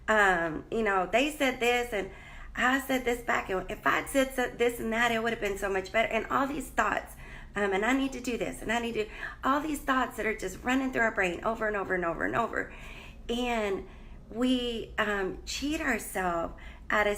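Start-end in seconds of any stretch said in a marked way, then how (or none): none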